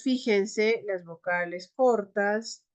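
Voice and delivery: ordinary talk, nothing marked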